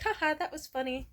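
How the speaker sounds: light mock chirpy voice; mock clueless friend. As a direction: lightly parody a thoughtless reply. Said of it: "and then be like".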